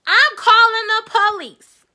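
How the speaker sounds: high, loud and slow; furious mom. Retold or imitated. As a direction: warn them she is calling the police. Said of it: "She was like".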